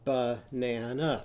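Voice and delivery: "Banana" slowly in three beats; syllable counting. Shown as a demonstration. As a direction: tap out each syllable of "Banana". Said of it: "count how many syllables".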